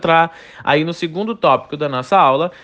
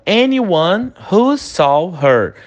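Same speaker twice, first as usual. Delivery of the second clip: slow model phrase; 2 semitones higher, much slower than usual. Shown as a demonstration. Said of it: "Repete a pronúncia comigo".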